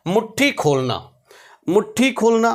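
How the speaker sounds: ordinary talk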